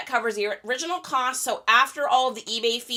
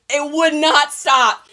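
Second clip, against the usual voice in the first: loud, slow stress on "would not stop"; "would not stop" stretched and punched loudly; emphatic frustration; 3 semitones higher, 8 dB louder, slower than usual. Real, not acted. Stressing an uncontrollable tic.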